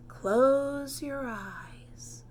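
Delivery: slow breathy whisper, drawn out; whispered instruction. A narrator's reading. Environmental therapist whispers to Camilla, slow and hushed. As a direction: hush it like a therapist easing a patient. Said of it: "she said".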